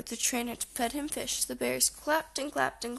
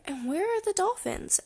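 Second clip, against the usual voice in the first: high-pitched little sister's question; impatient Sister; 8 semitones higher than usual. A narrator's reading. Sister wants the main attractions, asking in a high voice. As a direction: ask up high, climbing in pitch. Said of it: "asked Sister".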